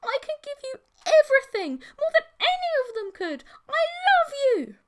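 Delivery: high, loud, strange desperate monster voice; shrieking monster plea of love; monster girlfriend pleading. Acted. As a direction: beg him like a clinging monster.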